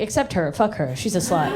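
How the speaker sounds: low, muttered catty aside; dismissive. Acted. Jealous woman spots someone hotter, low catty dismissal. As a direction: drop it low and write her off. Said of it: "hotter than you and you're like".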